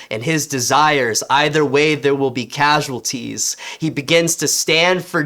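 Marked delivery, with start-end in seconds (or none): none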